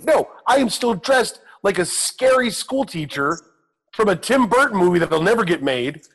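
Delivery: high, exasperated mock rant at the prankster; a hammed-up furious phone rant, half laughing; retold angry rant. To the coworker who hid his clothes, high exasperated mock rant.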